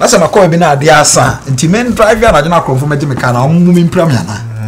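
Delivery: loud, high indignant outburst; worked-up, loud challenge; heated protest. Acted. Heated argument with the other speaker.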